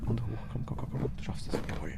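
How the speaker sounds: whispered urging, come on; softly whispered encouragement; whispering. Real, not acted.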